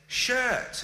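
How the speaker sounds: high mock-kid "Shirt" in a retold slip; childhood mispronunciation. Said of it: "when you were a kid ... you said".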